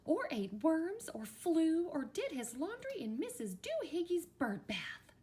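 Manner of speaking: high-pitched, indignant scolding. A narrator's reading. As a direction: scold through a list of misdeeds. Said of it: "she scolded".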